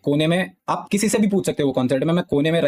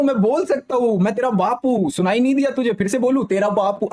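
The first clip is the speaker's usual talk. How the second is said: much higher, sneering imitation of a braggart; mocking the goblin guy; 7 semitones higher than usual. Telling chat how the guy bragged, high mocking imitation. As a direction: mimic the bully's boast in a high, taunting voice. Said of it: "soul का face".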